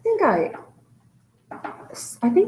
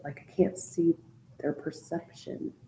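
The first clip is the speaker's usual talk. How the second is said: trailing whisper; 12 dB quieter than usual. Real, not acted. Frustrated teacher trailing off.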